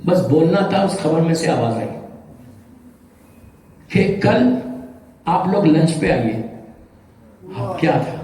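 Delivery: normal talking voice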